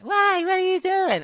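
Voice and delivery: put-on high girly whine; girl's voice. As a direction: play the needy female friend, high and sulky. Acted girl's voice in dialogue.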